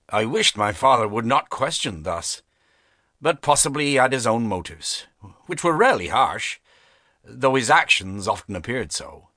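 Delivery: ordinary delivery, nothing marked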